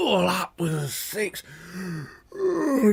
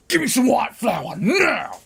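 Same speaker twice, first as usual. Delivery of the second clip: demanding wolf; 7 semitones higher, 3 dB louder, slower than usual. Acted. Wolf bursting into bakery.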